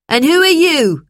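loud, high-pitched challenge; demanding teacher. A narrator's reading. Teacher turns on Lucy, loud demanding question.

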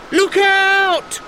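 high loud cry of alarm; yelled warning. A narrator's reading. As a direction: shout it as the tree falls. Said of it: "yelled Simon".

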